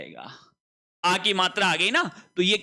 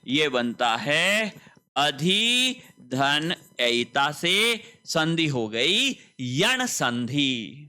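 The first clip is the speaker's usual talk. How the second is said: slow explanation; 4 semitones lower, much slower than usual. Shown as a demonstration. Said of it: "teacher spelling out sandhi".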